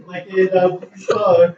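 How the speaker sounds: normal talking voice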